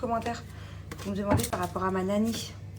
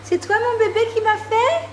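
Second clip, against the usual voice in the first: high sweet mom voice; 9 dB louder than usual. Real, not acted. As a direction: coo over the gift like a proud mom. Mother cooing to child.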